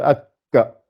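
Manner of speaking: "akka" with a held stop on the k; doubled k. Shown as a demonstration. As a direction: pause on the k in "akka", then release. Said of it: "ça rajoute toujours".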